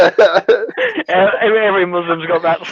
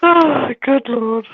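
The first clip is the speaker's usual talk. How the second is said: laughing exhausted; 8 semitones higher, slower than usual. Real, not acted. Recovering from laughing fit.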